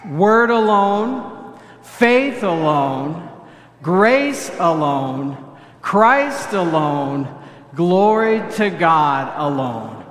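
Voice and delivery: slow, deliberate unison chant; congregational recitation. Real, not acted. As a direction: lead the congregation word by word. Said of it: "Say them with me".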